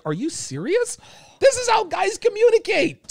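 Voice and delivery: squeaky high disbelief; shrill-free mock outrage at the advice; incredulous. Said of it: "and I'm like".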